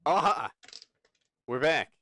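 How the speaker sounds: loud, high burst of discovery; triumphant exclamation. Real, not acted. Stream connection suddenly comes back.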